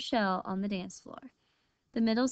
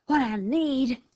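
higher-pitched, irritated coyote snap; snapped; 4 semitones higher, 4 dB louder than usual. A narrator's reading. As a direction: snap back at the insult. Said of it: "snapped the coyote".

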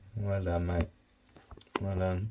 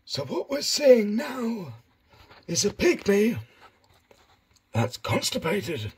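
higher, mock nature-documentary narrator voice; David Attenborough; 7 semitones higher than usual. Owner filming his hedgehog for viewers, mock Attenborough narration. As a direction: narrate the hedgehog like a wildlife film presenter. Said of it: "I've lost the David Attenborough".

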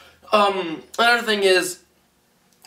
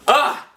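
disgusted ah; 5 semitones higher, 4 dB louder than usual. Real, not acted. Reacting to awful green tea.

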